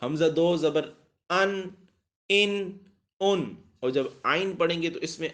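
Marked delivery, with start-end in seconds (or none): 0.0-2.8 ان said plainly from the throat, higher; straight hamza. Shown as a demonstration. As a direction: keep ان straight and clean. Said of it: "سیدھی سیدھی تھی ان".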